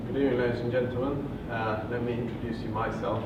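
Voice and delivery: ordinary talk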